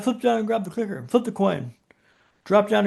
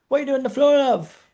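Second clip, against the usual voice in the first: put-on British accent; 6 semitones higher than usual. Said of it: "That's a fantastic British accent there".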